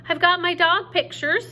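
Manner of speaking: put-on high, loud little girl's scream. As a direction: scream it out, proud of saving them. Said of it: "screamed Sweetie Pie".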